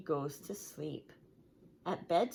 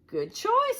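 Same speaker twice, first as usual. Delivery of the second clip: high, bright shopkeeper greeting; a hint of an eager salesman; 8 dB louder than usual. Mister Fox greets the pigs in his shop, high and eager.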